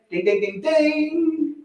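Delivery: sung-out "ding" ringing up high at the end; mock bell ding. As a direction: chime like a shop bell, last ding pitched high. Ringing in a sale.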